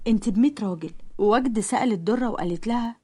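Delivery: ordinary delivery, nothing marked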